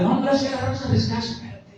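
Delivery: mock reluctant brush-off. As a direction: play the old self who can't be bothered. Old self brushing off preaching.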